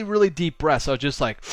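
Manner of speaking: usual speaking voice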